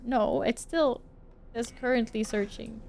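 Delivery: normal talking voice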